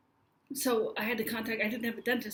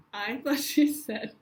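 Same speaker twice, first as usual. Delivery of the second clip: ordinary delivery, nothing marked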